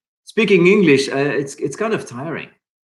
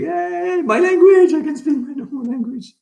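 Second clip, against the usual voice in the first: much higher, squealing with mock delight; overjoyed, bouncing mock cheer; imitating giddy joy. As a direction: play a kid thrilled to be home. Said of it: "so it's gonna be like".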